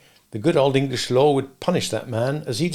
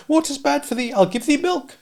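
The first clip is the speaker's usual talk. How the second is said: put-on high kindly old dame voice; a cottage dame fussing over a boy; 13 semitones higher than usual. Old dame offers the sooty boy milk, high and kindly.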